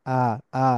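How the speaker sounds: আ eased in without a catch; smooth-onset English আ; English soft আ. Shown as a demonstration. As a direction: let আ glide out, long and open. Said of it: "ইংলিশে সবসময় আ উচ্চারণটা এরকম হয় না".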